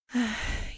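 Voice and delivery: soft exasperated sigh; audible sigh of mild exasperation; resigned sigh. Acted. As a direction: let out a weary breath before giving in. Resigned before conceding pets are fine.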